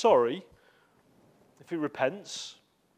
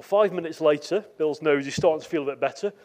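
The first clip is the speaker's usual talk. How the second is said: usual speaking voice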